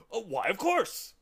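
loud, high, hearty agreement; cheerful. A narrator's reading. Humbug agreeing to help, loud and cheerful. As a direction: agree cheerfully with full volume. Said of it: "said the humbug cheerfully".

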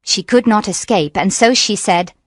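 ordinary delivery, nothing marked

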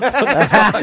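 high, loud laughing outburst; real laughing exclamation; laughing disbelief. Real, not acted. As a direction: laugh through the words. Laughing retelling the heckle.

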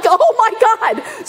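high, loud, drawn-out "oh my God"; retelling her shock at the calf's foot; shocked gasp. Retold or imitated. Sees calf's foot sticking out.

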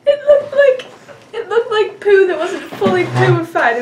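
high-pitched tearful laughter; laughing hysterically. Real, not acted. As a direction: talk through helpless laughter. Laughing hard at the mess.

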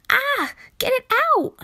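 high, loud panicked scream; shriek. A narrator's reading. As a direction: shriek at the sight of a mouse. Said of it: "she shrieked".

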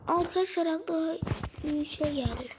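normal talking voice

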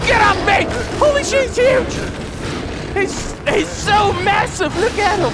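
loud high shouting at the tiger; loud high panicked shouting. Real, not acted. Attacked by tiger.

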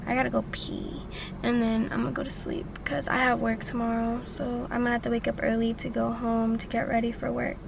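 unvoiced whisper; tired whisper. Real, not acted. Whispered at night outside.

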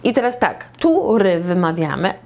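ordinary delivery

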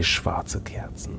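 slow, hushed spooky whisper; eerie whisper. A narrator's reading. Game master describing the dark room, slow eerie whisper. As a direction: whisper the candles like a ghost story.

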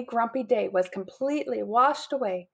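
usual speaking voice

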